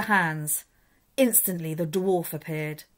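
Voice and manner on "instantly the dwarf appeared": usual speaking voice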